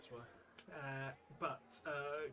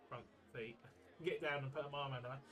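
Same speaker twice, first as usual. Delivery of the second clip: ordinary delivery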